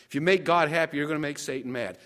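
ordinary talk

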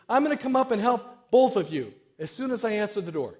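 high, loud holler for a joke's punchline; acted yell upstairs. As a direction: call out loudly to the floor above. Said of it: "she yells on up the stairs".

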